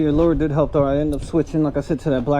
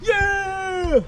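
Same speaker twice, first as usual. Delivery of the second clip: much higher, long drawn-out yells; triumphant yell; 14 semitones higher than usual. Real, not acted. Angler after releasing the fish, yelling in triumph. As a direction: shout it to the whole lake. Final celebration shouts.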